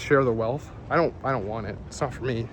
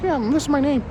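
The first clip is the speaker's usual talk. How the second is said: much higher, silly put-on voice; mock stranger voice; 11 semitones higher, faster than usual. Someone introducing their awful name, high mocking voice. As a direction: lampoon someone proudly stating their odd name. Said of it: "they're like".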